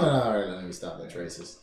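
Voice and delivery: normal talking voice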